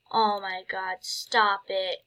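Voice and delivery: ordinary talk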